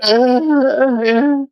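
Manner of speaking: high, slow, whiny fake-sick plea; mock pleading beggar. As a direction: ham up a sob-story plea for donations. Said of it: "and be like".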